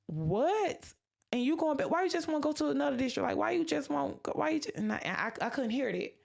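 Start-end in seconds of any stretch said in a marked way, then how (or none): 0.1-4.8 raised, fast disbelieving questions; higher, rapid mock outrage; incredulous questioning. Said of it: "they was just like".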